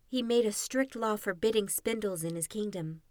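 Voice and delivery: usual speaking voice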